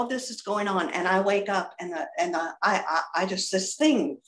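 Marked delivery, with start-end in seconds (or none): none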